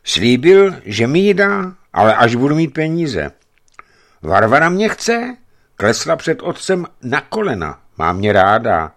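put-on high, loud, eager young giant; eager lover. A narrator's reading. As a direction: insist she loves him. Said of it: "dodal téměř šeptem".

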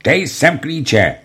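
high, slow, barked demand at gunpoint; roared order. A narrator's reading. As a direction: yell it slowly, pitched high. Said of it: "zařval".

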